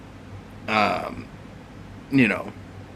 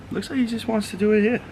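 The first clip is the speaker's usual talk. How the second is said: high, fast pet talk; baby talk; 10 semitones higher, faster than usual. Acted. Owner narrates dog's wishes in high baby talk. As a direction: chatter to the dog in a high voice. Talking about the dog.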